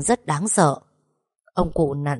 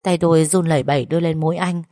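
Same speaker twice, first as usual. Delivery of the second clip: plain reading voice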